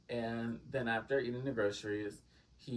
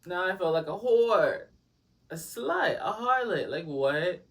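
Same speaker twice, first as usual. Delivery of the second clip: high, loud, scandalised rant; wounded pride, played up for laughs; indignant outburst; 8 semitones higher, 7 dB louder, slower than usual. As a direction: sound appalled at yourself. Outraged at being refused a kiss.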